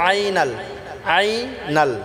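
আইনাল opening on a squeezed throat ayn. Shown as a demonstration. Teacher modelling correct আইনাল, throat-pressed ayn. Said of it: "গলায় চাপ দিয়ে উচ্চারণ করব".

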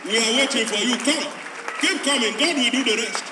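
loud, high calling out to the crowd; preaching at full force. Real, not acted. Preacher calling people to the altar, full force.

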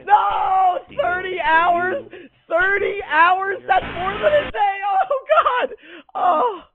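frantic high yelling in panic; panicked screaming. Real, not acted. Panic during chase.

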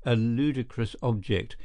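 ordinary delivery, nothing marked

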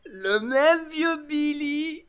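loud, high drawn-out crowing; gloating pirate. Acted. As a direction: crow it with wild glee at an old shipmate. Pirate gloats over old shipmate.

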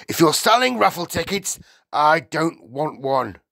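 high, loud, dismissive householder; brusque Mr Wormwood. A narrator's reading. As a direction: turn the caller away loudly.

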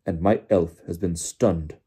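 slow, loud, word-by-word delivery; tight-lipped and deliberate; Crouch, jerky. A narrator's reading. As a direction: barely move the lips, force each word out. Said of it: "still talking in the same jerky fashion".

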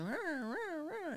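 high wailing siren imitation; voiced ambulance wail; imitated siren. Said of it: "I hear the sirens going".

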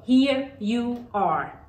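very slow, word by word; slow model phrase. Shown as a demonstration. Teacher models a child's polite reply, very slowly. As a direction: stretch each word for learners to copy.

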